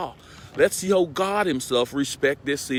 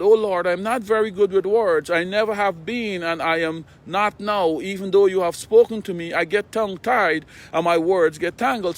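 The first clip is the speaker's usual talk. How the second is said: pleading Moses; 6 semitones higher, 4 dB louder than usual. Retold or imitated. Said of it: "Moses pleaded with God".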